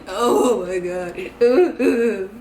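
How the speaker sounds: very slow, sobbing oh my God; crying. Retold or imitated. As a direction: drag it out through tears. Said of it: "So I'm crying".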